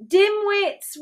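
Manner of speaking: high-pitched exclamation over a din; storybook roar. A narrator's reading. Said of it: "roared Duke Dudley".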